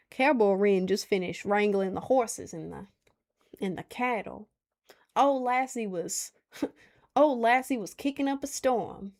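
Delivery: put-on cowboy Southern accent. Shown as a demonstration. Streamer performs best Southern accent on a viewer's request, cowboy Southern accent. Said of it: "Do your best Southern accent".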